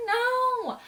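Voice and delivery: high, loud cry of disbelief; shocked no. Retold or imitated. Fan reliving her shock at the twist, high and loud. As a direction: gasp it out in horror.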